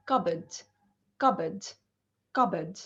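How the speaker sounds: CUPBOARD as "CUB-id", no r, falling each time; silent R. Shown as a demonstration. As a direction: model "cupboard" with silent p and r, pausing after each. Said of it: "the R is silent here".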